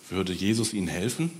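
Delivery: ordinary delivery, nothing marked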